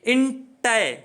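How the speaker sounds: इंटे broken off and held; deliberate इंटे, stopping before the word is finished; syllable-by-syllable pronunciation. Shown as a demonstration. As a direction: sound out इंटे as separate syllables. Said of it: "उसी प्रकार देखो इंटेलिजेंट का".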